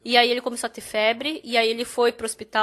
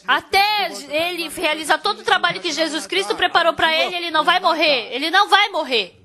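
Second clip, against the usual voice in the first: proclamação alta e elevada, cheia de fé; pregação com força; 3 semitones higher, 4 dB louder than usual. Acted. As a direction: declare com autoridade de pregador. (pastor declara com fé que ele não vai morrer)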